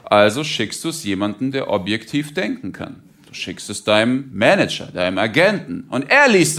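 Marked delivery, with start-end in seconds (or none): none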